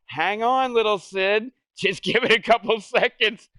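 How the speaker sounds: high, loud cooing voice with a laugh; baby talk. Retold or imitated. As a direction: beg the baby to hold on, half laughing. Cooing to baby, mom arriving.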